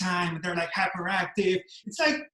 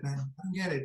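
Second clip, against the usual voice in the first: ordinary talk, nothing marked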